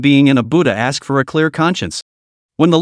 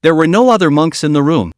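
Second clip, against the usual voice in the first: ordinary delivery, nothing marked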